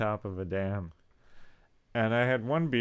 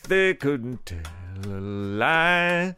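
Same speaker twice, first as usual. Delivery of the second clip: toothless voice; 7 semitones higher, 7 dB louder, much slower than usual. Pretend. Toothless George Washington joke.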